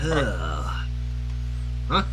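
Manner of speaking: ordinary delivery, nothing marked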